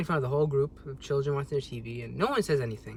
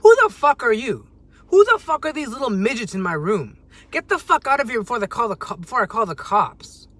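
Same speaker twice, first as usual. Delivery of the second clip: much louder, higher angry outburst; furious comeback; 7 semitones higher, 9 dB louder than usual. Pretend. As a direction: blow up at the intruder. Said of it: "what I felt like saying".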